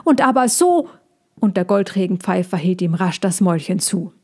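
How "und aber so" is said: high, louder squirrel cry; calling out. A narrator's reading. Squirrel carrying on before being shushed, calling out. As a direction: pipe up excitedly until cut off. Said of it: "rief das Eichhörnchen weiter".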